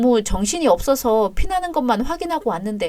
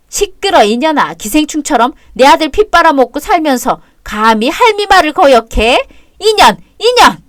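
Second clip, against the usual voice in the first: furious yelling; 4 semitones higher, 6 dB louder than usual. Acted. Mother-in-law yelling, attacking the child.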